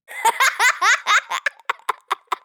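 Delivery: high, rattling burst of laughter. A narrator's reading. Pupil cracking up at teacher's joke, bursting out laughing. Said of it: "I burst out laughing".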